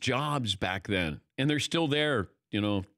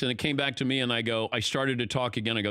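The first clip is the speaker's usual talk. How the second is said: normal talking voice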